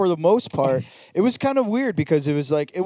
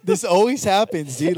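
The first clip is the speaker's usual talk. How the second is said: normal talking voice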